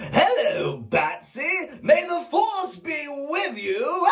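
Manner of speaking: high, slow cackling Joker voice; lilting villain calling Batsy, drawn out; Mark Hamill. Retold or imitated. Joker teasing Batman, high and slow. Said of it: "Mark Hamill".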